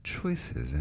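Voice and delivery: low, soft, knowing drawl; sly and scheming; sly retort. Retold or imitated.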